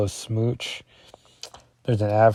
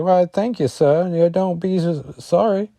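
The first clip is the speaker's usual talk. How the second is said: put-on Husk's Kentucky accent; 8 semitones higher, 3 dB louder than usual. Reader voices Husk answering the man, hammed-up Kentucky accent. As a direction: put on a hammy Southern-belle Kentucky accent. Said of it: "This is my Husk impression".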